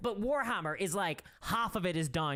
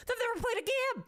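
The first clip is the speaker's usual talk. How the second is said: incredulous. Acted. Mocking armchair theory crafters.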